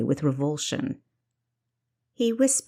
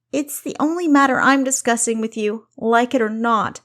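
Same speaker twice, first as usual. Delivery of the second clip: higher, louder angry snarl; 6 semitones higher, 6 dB louder than usual. Acted. Kessa refusing to drop the marriage, snarling louder. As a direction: bite it out, furious. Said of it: "Kessa snarled".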